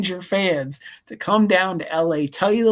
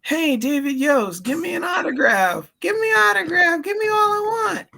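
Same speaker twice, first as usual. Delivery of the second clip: whiny demanding fan. Retold or imitated. Brent mocked demanding an autograph from David Yost, high shouty whine. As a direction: squeal it like a greedy fan begging. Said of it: "So Brent goes".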